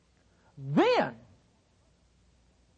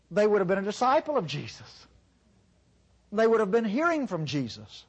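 usual speaking voice

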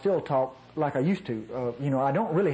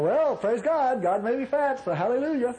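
hammed-up fake piety, pitched up; mock hallelujah from an overweight believer; mock pious voice; 7 semitones higher than usual. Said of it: "you know".

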